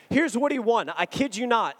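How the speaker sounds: ordinary talk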